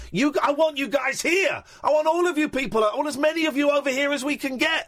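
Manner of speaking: high-pitched, worked-up plea; impassioned appeal. Real, not acted. Mock rant to the listeners.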